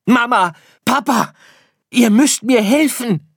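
high, loud desperate shout; lost little star bellowing for parents; yelling for help. Acted. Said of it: "brüllte Sirius". (laut um Hilfe brüllen)

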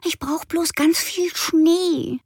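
put-on high, slow little-girl voice; a small girl begging for snow; pleading girl. A narrator's reading. Frieda wishes for snow, high and slow. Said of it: "wollte Frieda sagen".

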